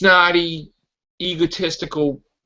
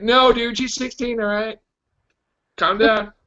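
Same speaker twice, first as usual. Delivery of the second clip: higher-pitched retelling of a dude intervening; acted plea, pitched up without shouting; lightly acted Cyclops; 6 semitones higher than usual. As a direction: lightly act out talking a friend down. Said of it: "He's like".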